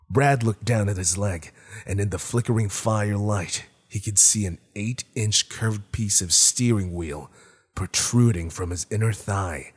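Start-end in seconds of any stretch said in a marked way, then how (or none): none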